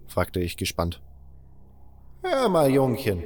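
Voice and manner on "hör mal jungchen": put-on slow, higher old man turning grave; slow down and warn him earnestly; serious. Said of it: "sagte Grimes ernst".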